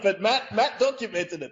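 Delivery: laughs through the words; amused, chuckling while talking; giggling as he points at Matt; laughing. Real, not acted. Laughing over Greg's intro.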